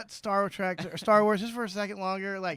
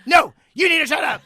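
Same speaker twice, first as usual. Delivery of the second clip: loud retort; 5 semitones higher, 9 dB louder than usual. Acted. Retorting to the mocked poster.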